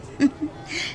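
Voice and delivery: breathy mocking laugh; teasing giggle. Maid giggling while teasing about Cherry. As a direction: giggle at the gossip.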